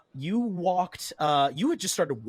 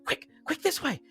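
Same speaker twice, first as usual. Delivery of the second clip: high, hurried fugitive calls; urgent crowd voices; 6 dB louder than usual. Acted. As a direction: call out urgently as if fleeing. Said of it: "you hear voices saying".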